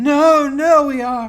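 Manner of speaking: very high, loud shrieking creature; frantic creature. Acted. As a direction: shriek it out like a panicked monster. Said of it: "reacts savagely".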